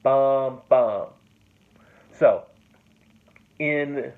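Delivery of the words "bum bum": "Bum, bum" both loud and even; two heavy equal beats. Shown as a demonstration. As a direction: knock out the spondee rhythm. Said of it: "two long syllables".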